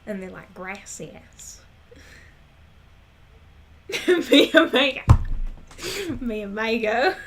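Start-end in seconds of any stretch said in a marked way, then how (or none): 0.5-1.6 mock Texan twang on Spanish; soft Southern gringo Spanish; Southern-accented Spanish. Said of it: "the people with accents when they try to speak Spanish". 4.1-7.1 loud mock Texan twang on Spanish; hammed-up Southern gringo Spanish, loud; Southern-accented Spanish. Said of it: "the people with accents when they try to speak Spanish".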